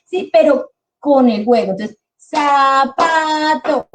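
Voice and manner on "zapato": "zapato" split slowly into za-pa-to; clapped syllables. Shown as a demonstration. Teacher showing parents how to clap "zapato", very slow. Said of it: "vamos a dar una palmada con cada sílaba".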